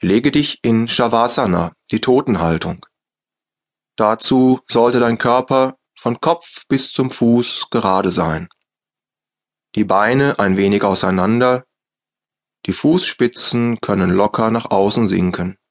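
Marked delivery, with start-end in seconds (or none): none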